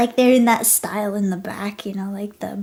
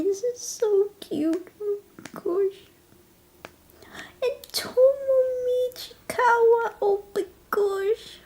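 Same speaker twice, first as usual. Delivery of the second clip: excited squeal; 10 semitones higher than usual. Real, not acted. Squealing over cute fan art.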